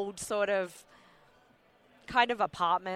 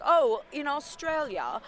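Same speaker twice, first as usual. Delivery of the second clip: slow, higher British accent as a fake Australian; mock British accent; 5 semitones higher, slower than usual. Shown as a demonstration. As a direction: play an American faking Aussie with a British accent. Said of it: "I do a British accent".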